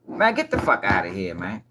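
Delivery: low, dropped, disbelieving brush-off; low scoff at a lie; scoffing dismissal. Real, not acted. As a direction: wave it away in disbelief.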